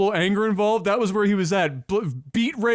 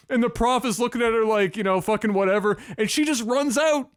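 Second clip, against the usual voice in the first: ordinary talk, nothing marked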